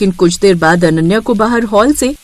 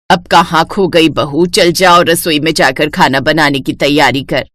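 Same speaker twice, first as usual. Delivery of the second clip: low, bossy mother-in-law voice; a domineering saas giving orders; lower-pitched strict older woman; stern mother-in-law; 7 semitones lower than usual. Acted.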